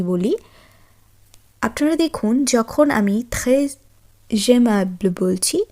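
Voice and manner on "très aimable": "très aimable" said with the s linked to the vowel as a z sound, tre-z-aimable; French liaison demo. Shown as a demonstration. Teacher showing students the French liaison, s sounding as z before a vowel. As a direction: join the s of "très" onto "aimable" as a buzzing z. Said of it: "এস এর সাথে আ অ্যালফাবেটটি একত্রিত উচ্চারণ হচ্ছে এবং সেটি উচ্চারণ হয়ে যাচ্ছে জে".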